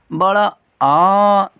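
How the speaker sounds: बड़ा आ dragged out very slowly; long drawn-out aa. Shown as a demonstration. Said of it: "बेटा इसको ऐसे पढ़ते हैं".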